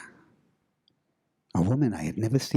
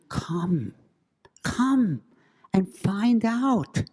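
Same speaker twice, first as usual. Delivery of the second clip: high, slow teasing lure; mock ghost call; 8 semitones higher, much slower than usual. Narrator echoes the ghostly woman's lure, high and slow. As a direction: echo the ghost's invitation.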